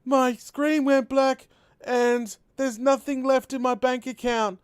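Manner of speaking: high, slow panicked voice; panicked brother. Retold or imitated. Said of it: "He's like".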